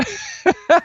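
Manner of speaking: short high-pitched giggle; a quick squeaky laugh. Real, not acted. Laugh at joke.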